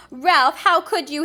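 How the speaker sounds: appalled at her son; shocked gasp. Acted. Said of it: "his mother gasped".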